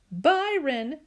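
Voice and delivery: a touch of tears on the name; near tears. Acted. Mama calls to Byron, near tears. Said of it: "sounded like she was gonna be crying".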